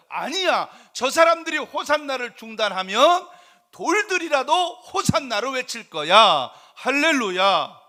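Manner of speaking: loud, high preacher's cry; emphatic proclamation. Acted. Preacher voicing Jesus refusing to silence the crowd, loud proclamation. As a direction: shout it out, slowing into the final word.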